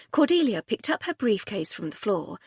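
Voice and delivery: ordinary delivery, nothing marked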